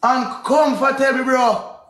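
ordinary delivery

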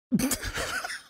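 short, quiet breathy laugh. Real, not acted. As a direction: let out a little airy chuckle. Storyteller laughs at the story.

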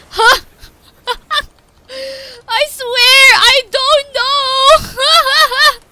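high, loud, half-crying laugh-talk; real overwhelmed laughter; laughing and near tears. Real, not acted. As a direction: gasp it out through giddy tears. Overwhelmed after best run ever.

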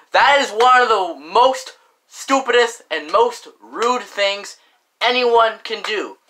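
ordinary delivery

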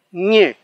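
Ñe said with a single velar ng, not ñ; one sound ng. Shown as a demonstration. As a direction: say Ñe starting on back nasal ng. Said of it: "La N G se pronuncia como una sola consonante".

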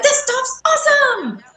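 be the dog thrilled by the snow; squeaky excited dog voice; happy dog voice. Pretend.

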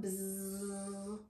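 low, soft sustained buzzing; imitated fly buzz. Shown as a demonstration.

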